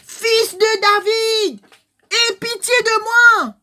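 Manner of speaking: high, slow, loud plea for mercy; crying out. Acted. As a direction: call out to him across the crowd, drawn out. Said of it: "crier, les crier".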